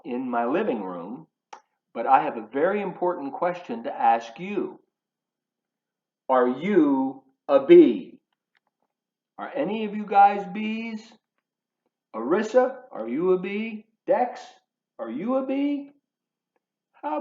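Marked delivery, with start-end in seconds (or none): none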